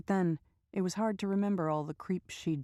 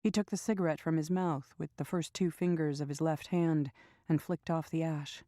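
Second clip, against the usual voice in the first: usual speaking voice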